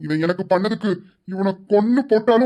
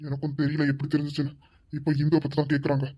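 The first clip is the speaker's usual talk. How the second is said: softer and lower, anxious murmur; hushed worry; 5 semitones lower, 8 dB quieter than usual. Acted. Husband confides in wife, hushed worry. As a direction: hide the panic under a hush. Conspiratorial whisper between couple.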